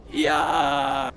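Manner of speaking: loud shout of Yeah; dwarf battle yell. Retold or imitated. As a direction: bellow it like a dwarf charging. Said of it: "he had set up a macro to scream in-game".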